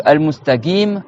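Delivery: al-mustagim with g for qaf; hard g replacing q in mustaqim; wrong g in al-mustaqim. Shown as a demonstration. Teacher rules out another mistake, demonstrating g for qaf. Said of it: "C'est pas non plus".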